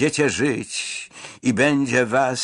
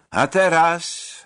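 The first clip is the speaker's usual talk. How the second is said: "A teraz" called out high, slow and louder; loud announcement; 6 semitones higher, slower than usual. A narrator's reading. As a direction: raise the voice for "A teraz". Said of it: "dodał głośno". (głośno i wolno: „A teraz”)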